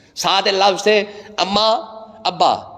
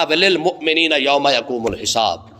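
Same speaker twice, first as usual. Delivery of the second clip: Quranic recitation; 7 semitones lower than usual. Shown as a demonstration. Quoting Quranic verse in prayer.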